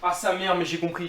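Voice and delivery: sudden realization. Real, not acted.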